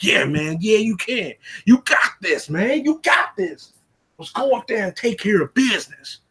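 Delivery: hype man pep talk. Retold or imitated. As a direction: pump up your buddy before the fight. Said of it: "he's like".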